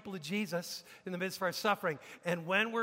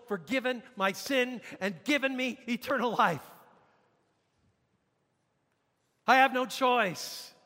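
high, raised, fervent rhetorical questions; impassioned plea; 4 semitones higher, 4 dB louder than usual. Acted. Preacher voices forgiven Stephen refusing a grudge, impassioned plea. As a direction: plead it phrase by phrase with conviction.

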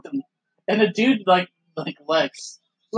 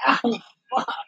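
a lighter mock yelp of pain; toned-down imitation of a hurt wrestler; 6 semitones higher than usual. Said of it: "And he goes".

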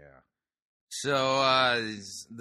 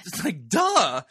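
sarcastic duh; 6 semitones higher, 4 dB louder, slower than usual. Pretend. Mocking quip.

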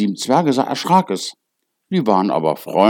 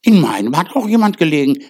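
higher, louder and fast, dwarfs calling out; excited call; 7 semitones higher, 5 dB louder, faster than usual. A narrator's reading. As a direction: blurt it out eagerly. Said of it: "Die anderen kamen gelaufen und riefen". (aufgeregter Ruf, höher und lauter)

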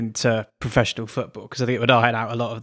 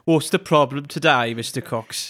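ordinary delivery

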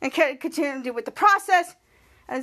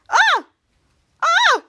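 two high, loud yelps; shocked yelps; 13 semitones higher, 7 dB louder than usual. Acted. Frank finding himself as Captain Man, shocked high yelps. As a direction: squeal in mock shock, twice.

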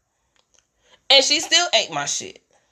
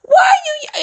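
shocked outburst; slower than usual. Retold or imitated. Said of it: "I'm like".

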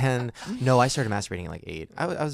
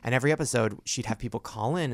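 normal talking voice

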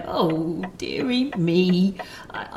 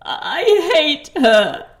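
put-on childlike sulk; 7 semitones higher, 5 dB louder than usual. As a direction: pout like a small child about the lady.

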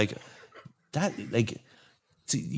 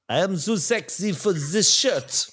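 slow, higher-pitched mock Spanish accent; bad Spanish accent; 10 semitones higher, 4 dB louder, slower than usual. Host imagining someone filming a forfeit clip, bad Spanish accent. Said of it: "that's my bad Spanish accent".

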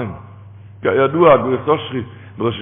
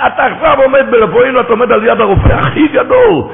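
high, loud preaching, slowing at the end; rousing shout; 7 semitones higher, 6 dB louder than usual. Real, not acted. Preacher shouting to the congregation about standing before God. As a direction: cry it out to stir the congregation.

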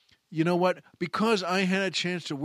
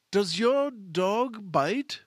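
slow, careful Clouseau; 5 semitones higher, much slower than usual. Retold or imitated. Clouseau quote in retold scene.

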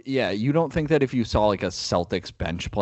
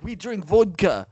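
higher, hammed-up Russian accent; mock Russian accent; 9 semitones higher than usual. Joking about Russians and vodka, in a hammed-up Russian accent. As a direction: play a comic Russian player in a Russian accent. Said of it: "Is that a good Russian accent?".

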